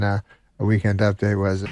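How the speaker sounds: usual speaking voice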